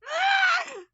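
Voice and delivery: shrieking high burst of laughter; loud laugh. Real, not acted. Reader cracking up at her own bad accent, loud laugh. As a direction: let out a loud squealing laugh.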